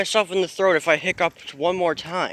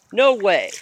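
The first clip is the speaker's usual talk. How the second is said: ordinary delivery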